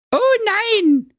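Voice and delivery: alarmed sister. Acted. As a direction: cry out like a startled kid. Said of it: "rief dem Brudi seine Schwester".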